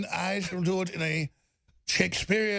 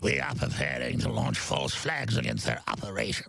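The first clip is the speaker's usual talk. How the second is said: brief the war plan like a cold British villain; authoritarian British accent; 3 semitones lower than usual. Pretend. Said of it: "Very authoritarian British accent".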